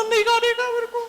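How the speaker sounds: put-on very high, loud whiny kid refusing; a small child digging in his heels; stubborn little boy. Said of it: "बोला ना". (ज़िद्दी छोटे बच्चे की ऊँची आवाज़)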